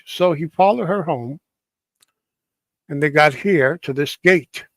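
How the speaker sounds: ordinary delivery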